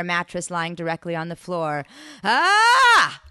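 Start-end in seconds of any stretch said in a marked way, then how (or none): 2.2-3.2 high, loud "ah!"; full-voiced yelp of alarm; startled scream. A narrator's reading. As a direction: scream out in sudden shock. Narrator ambushed by leaping man.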